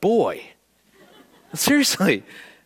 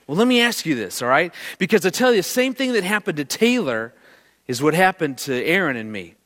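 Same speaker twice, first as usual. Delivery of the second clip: ordinary talk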